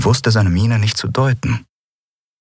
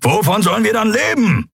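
high, heated questioning; agitated protest; 10 semitones higher than usual. Acted. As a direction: fire off worried, indignant questions at the captain. Heated quarrel over unpaid debts.

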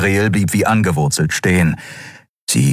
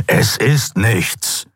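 slow, higher creature snarl; 5 semitones higher, much slower than usual. A narrator's reading. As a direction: snarl the dismissal, drawing it out. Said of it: "fauchte der Skria".

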